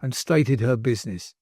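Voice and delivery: usual speaking voice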